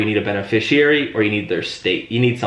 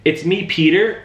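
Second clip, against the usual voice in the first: higher voice, falling on Peter; familiar inflection; 7 semitones higher, 3 dB louder, slower than usual. Shown as a demonstration. Trainer showing the familiar tone to agents, pitched higher. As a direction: sound like an old friend. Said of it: "hear the inflection at the end there".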